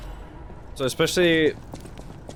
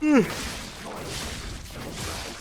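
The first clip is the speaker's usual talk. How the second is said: long sigh; 13 semitones higher, 7 dB quieter than usual. Real, not acted. Failing to sleep.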